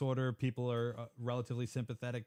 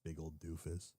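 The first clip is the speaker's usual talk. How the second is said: quiet insult under breath; muttered aside; 6 semitones lower, 12 dB quieter than usual. Real, not acted. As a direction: mutter it to yourself.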